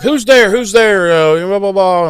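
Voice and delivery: high, loud calling out; mock scared lad. Host mimics the lad calling into the dark, high and loud. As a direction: yelp into the dark. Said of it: "he's like".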